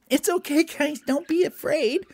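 high, reassuring elf voice; squeaky little elf calming friends; Gerald. Acted. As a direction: comfort them in a tiny high-pitched voice.

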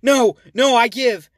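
high, loud panicked yelling; terrified shout. Acted. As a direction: scream it in terror. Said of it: "shouted a terrified Clay".

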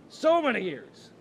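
loud, high, drawn-out mock wail; hammed-up wail. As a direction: belt it out slowly in a high voice. Repeats it louder for comic drama.

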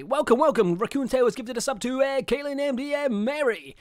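loud, high hype announcer. Acted. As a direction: shout the shoutout like a radio DJ. Keeps the DJ announcing.

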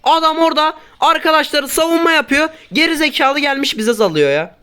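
high, heated complaint; angry rant. Real, not acted. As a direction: vent angrily at the player. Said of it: "Biraz sinirlendim". (öfkeli, tiz şikâyet)

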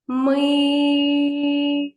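«Ми» held long on one high steady note; drawn-out syllable. Shown as a demonstration. Teacher pulling the syllable long for children. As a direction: stretch «Ми» like a sung note. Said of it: "Потягнули".

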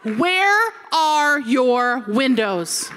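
very slow, drawn-out demand; slow, deliberate mock desperation; emphatic question. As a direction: stretch out every word for comic emphasis. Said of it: "I asked the lady".

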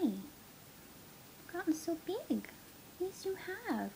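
high, soft slow coo. Real, not acted. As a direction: coo it softly. Cooing softly at puppy.

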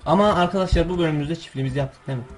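usual speaking voice

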